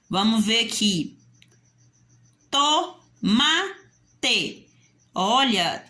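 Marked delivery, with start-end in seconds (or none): 2.5-3.4 "tomate" split TO... MA... TE; three detached syllables, to / ma / te. Shown as a demonstration. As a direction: say "tomate" in three separate beats with pauses. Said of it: "três sílabas".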